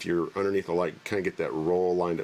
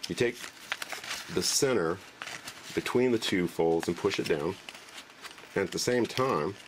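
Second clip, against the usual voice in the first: ordinary talk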